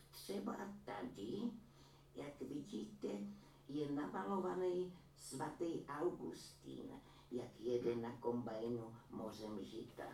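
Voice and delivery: a granny boasting about her son's painting; proud old woman. A narrator's reading. Old woman voiced in story. (vyšší pomalý hlas pyšné stařenky)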